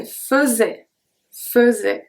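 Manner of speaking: faisait as fe-zay; correct pronunciation. Shown as a demonstration. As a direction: schwa in faisait. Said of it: "I say".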